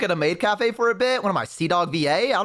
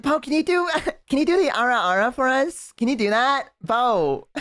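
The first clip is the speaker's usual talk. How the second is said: high, giggly mock fan voice; pleading fans; 7 semitones higher than usual. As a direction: wheedle like an imitated eager fan. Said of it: "you're like".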